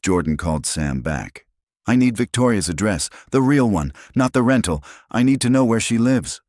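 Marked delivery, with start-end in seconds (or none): 1.9-6.4 insistent, pushing hard; raised urgent voice; urgent demand. Acted. Jordan demands her address.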